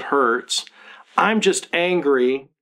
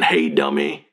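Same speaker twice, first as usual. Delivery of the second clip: much lower, gruff insult in a different voice; mocking a son in a sitcom dad's voice; Red Fox; 11 semitones lower than usual. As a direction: growl it like a sitcom grump. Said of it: "I was like Red Fox saying".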